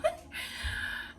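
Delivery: quick breathy giggle; soft laugh. Real, not acted. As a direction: laugh it off, a little embarrassed. Admits the real order.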